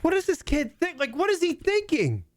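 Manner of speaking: high, incredulous retelling; baffled disbelief. Retold or imitated. Host retells his reaction to the kid, high and incredulous. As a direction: squeak out your disbelief at the kid. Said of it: "I was like".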